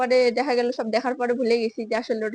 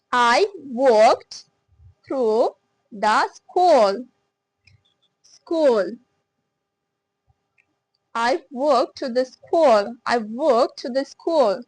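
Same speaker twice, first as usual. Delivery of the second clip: very slow and louder, long oo in school; slow, loud drill; 5 dB louder, much slower than usual. Shown as a demonstration. Teacher drilling long-oo sentence, very slow and louder. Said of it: "একালিপ টানতে হবে".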